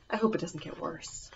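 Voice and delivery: very soft dread; worried mutter. Pretend. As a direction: dread what comes next. Dreading the next lines.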